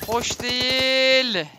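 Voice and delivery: very slow, strained groan; slow strained real groan; groaned complaint. Real, not acted. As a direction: drag it out in pain. Taking damage, groaning.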